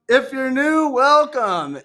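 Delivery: high, singsong host welcome; podcast intro. Pretend. Host welcomes new and returning listeners, high showy intro. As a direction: pitch it up into a showy greeting.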